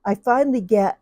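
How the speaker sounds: ordinary talk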